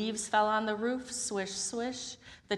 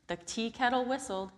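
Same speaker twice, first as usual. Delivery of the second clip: ordinary delivery, nothing marked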